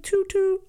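high little "toot toot"; horn toot. Retold or imitated. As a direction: chirp it like a tiny horn. Imitating a cute horn.

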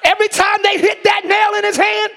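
full-force preaching. Acted. Preacher to church about the nails and the cross, preaching hard. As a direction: drive each phrase home to the congregation.

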